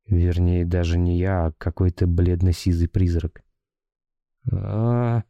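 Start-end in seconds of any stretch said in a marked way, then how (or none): 4.5-5.2 high thin moan like a sigh; thin ghostly moan. A narrator's reading. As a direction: let out a weak high groan. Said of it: "застонал я".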